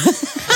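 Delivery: sharp high-pitched laugh; high laugh burst. Real, not acted.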